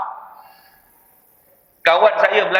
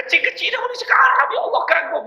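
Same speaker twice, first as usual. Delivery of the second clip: much higher, fast and amazed; gushing auntie; faster than usual. Retold or imitated. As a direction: squeal it like an overwhelmed auntie. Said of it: "Dia kata / Dia terharu".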